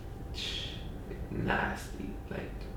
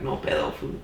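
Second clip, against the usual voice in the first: No pedo said lower, small and timid; scared; 8 semitones lower than usual. Shown as a demonstration. How he really said it to the knife guy, scared. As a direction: shrink back as you say No pedo. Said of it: "I said it scared".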